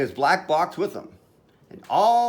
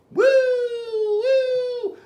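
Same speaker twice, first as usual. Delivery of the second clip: high, sustained siren wail; long high wooing sound; siren imitation; 4 dB louder than usual. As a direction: imitate a fire truck siren. Narrator imitates fire truck siren.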